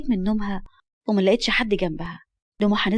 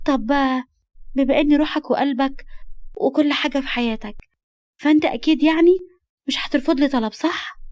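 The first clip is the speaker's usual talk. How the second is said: put-on higher, girlish wheedling voice; coaxing girl; 5 semitones higher than usual. Girl about to ask her guardian a favour, coaxing. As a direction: build sweetly toward asking a favour.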